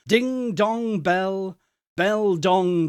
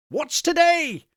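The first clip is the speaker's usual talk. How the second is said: calling down; 11 semitones higher than usual. Acted. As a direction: call down to a boy. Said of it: "calling downward to a boy".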